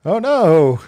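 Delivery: hammed-up damsel-in-distress alarm; mock dismay. Mock reaction to men threatening society.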